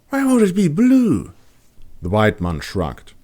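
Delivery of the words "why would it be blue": put-on high, curious young woman's voice; wondering aloud at the empty sky; Kat's voice. A narrator's reading. Kat gazing upward, puzzled and high-voiced.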